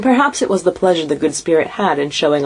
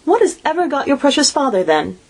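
a mother's voice pitched much higher, fussing; 10 semitones higher than usual. A narrator's reading. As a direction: fret over the missing father. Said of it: "said Missus Cratchit".

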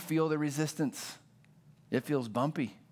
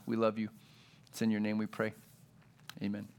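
prayer; 4 semitones lower than usual. Acted. Pastor praying over the congregation before the sermon, hushed.

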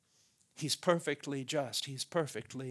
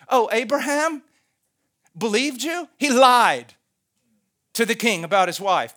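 high, loud outraged protest; railing accuser; 6 semitones higher, 12 dB louder than usual. Acted. Accuser throws Abraham's lie at God, railing. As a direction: rail at God, punching every charge. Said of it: "a railing in their voice".

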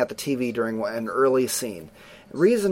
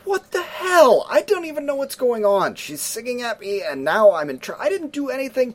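put-on indignant boy; 10 semitones higher than usual. Brother protesting to mom, high outraged whine. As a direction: protest your innocence, wronged. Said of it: "he's like".

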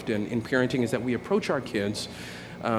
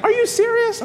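very high, loud squeal of disbelief; mock incredulous; 7 dB louder than usual. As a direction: imitate a stunned kid, pitch shooting way up. Son reacts to threat of death.

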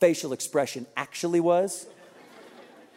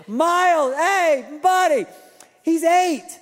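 higher, louder coaxing call; 8 semitones higher, 4 dB louder, slower than usual. Retold or imitated. Dad calling to Miles for a picture, higher and louder. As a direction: wheedle the mascot for a photo.

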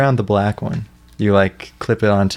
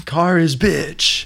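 Jesse; 6 semitones higher, much slower than usual. Retold or imitated. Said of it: "Jesse would be like".

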